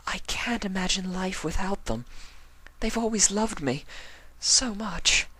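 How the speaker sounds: clinging to her parents' love; pleading Octavia. Acted. Octavia explaining to Vinyl she can't imagine life without her parents, fragile.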